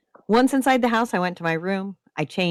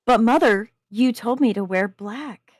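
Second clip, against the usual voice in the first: mock innocence; 6 semitones higher, 4 dB louder than usual. A narrator's reading. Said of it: "I said in mock innocence".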